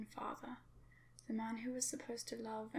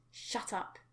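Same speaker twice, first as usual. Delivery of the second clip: growl; 3 dB louder than usual. Acted. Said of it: "he growled".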